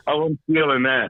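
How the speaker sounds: ordinary delivery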